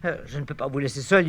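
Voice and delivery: ordinary talk, nothing marked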